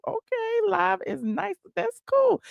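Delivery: pleasantly surprised at a comment. Real, not acted. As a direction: rise way up in delight. Reacting to comment.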